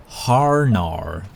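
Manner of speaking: "Harnar" with exaggerated American Rs; fake American accent. Shown as a demonstration. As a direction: drag out both Rs in "Harnar", mock-American. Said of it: "the way I express that as a joke".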